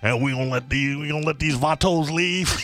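exaggerated mock Spanish accent; Castro accent. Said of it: "Is that your Castro accent?".

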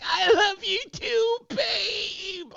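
very high, slow falsetto; hammed-up drawn-out wail of devotion; imitating driver's girlfriend. Girlfriend answering her arrested man, slow mock falsetto wail. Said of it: "And she's like".

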